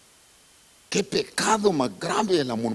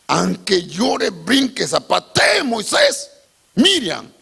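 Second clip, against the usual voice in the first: from slow and loud up to a high shout; building shout; 8 semitones higher, 7 dB louder, slower than usual. Acted. Preacher insisting Moses won't relent, building to a shout. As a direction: drive it up to a shout.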